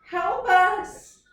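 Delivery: loud high call for help. Acted. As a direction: shout out across the water. Bears calling for help.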